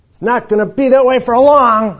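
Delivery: loud, high-pitched shout; sudden outburst. Acted. Preacher warning that God's anger is coming, shouted. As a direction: burst out to shatter the calm.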